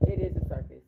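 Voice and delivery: breathy whisper, letting you in on it; conspiratorial whisper; whispered aside. Real, not acted. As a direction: drop to a hush for the punchline. Aside about the chaotic scene.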